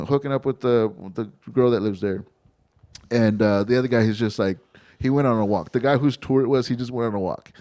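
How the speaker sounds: normal talking voice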